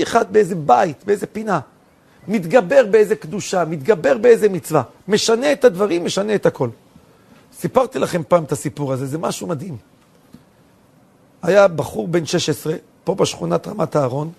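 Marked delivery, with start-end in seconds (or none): none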